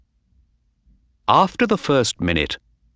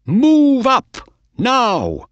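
cheering; 5 dB louder, much slower than usual. A narrator's reading. Owner cheering his horse.

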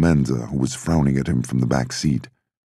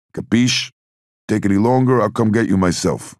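gruff Italian threat; 8 semitones higher, 3 dB louder than usual. Acted. Clemenza threatening Sonny.

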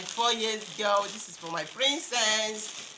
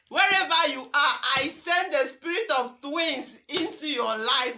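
loud, rising prayer blessing; fervent prayer; 2 semitones higher, 4 dB louder than usual. Real, not acted. Vlogger blessing the gift-giver in fervent prayer. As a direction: pray over her with full force.

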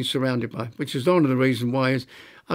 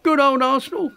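high, louder quoted cheer; imitated cheering mother; 13 semitones higher, 5 dB louder than usual. Mother used to say it of her team, high fond cheer. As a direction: pitch it right up like Mum's saying.

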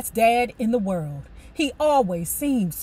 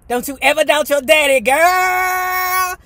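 loud, fast, higher boasting dad; proud father; 6 semitones higher, 8 dB louder than usual. Retold or imitated. Father bragging to his daughter, loud boastful crow. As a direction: crow with pride.

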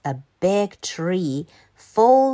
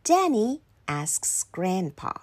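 ordinary delivery, nothing marked

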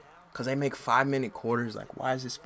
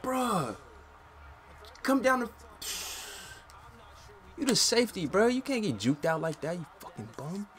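high-pitched exasperated berating; angry ranting; 10 semitones higher than usual. Real, not acted. Gamer scolding his in-game safety, exasperated. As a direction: chew out the safety, voice still up.

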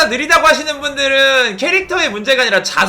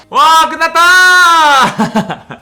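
웃으며 길게 늘인 환호; 끝났다고 크게 외치며 웃기; 신나서 외침; 7 semitones higher, 8 dB louder, much slower than usual. Real, not acted. Finishing the dungeon run. (웃음 섞인 환호)

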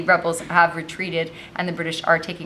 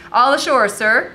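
loud, raised soldier's call; brisk dockside announcement; 5 semitones higher, 6 dB louder than usual. A narrator's reading. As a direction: call up to the officer. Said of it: "called the soldier".

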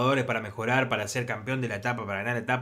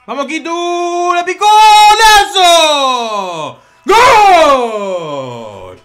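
high scream stretched into long goal calls; full-lunged, drawn-out football celebration; ecstatic goal scream; 12 semitones higher, 15 dB louder, much slower than usual. Real, not acted. Celebrates a chipped penalty goal. (grito de golazo eufórico y alargado)

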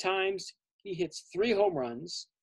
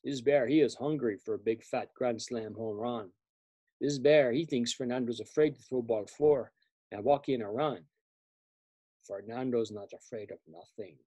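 lower, cocky pitcher sizing up the batter; lower, scheming pitcher talking himself up; pitcher's inner thoughts; 8 semitones lower than usual. Acted. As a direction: muse low and sure of yourself. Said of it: "So he's thinking".

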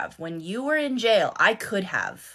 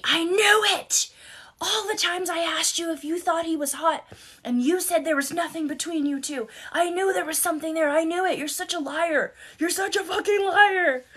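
high, whiny mocking voice for the husband, a touch faster in the middle; 10 semitones higher than usual. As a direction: mimic the accusing husband in a shrill-pitched mockery. Husband accuses her of lying.

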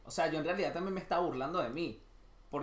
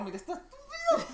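very high mock disdain, half laughing; burla despectiva. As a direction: squeal it scornfully in fun. Mocks someone who replied to him. (desprecio agudo y burlón)

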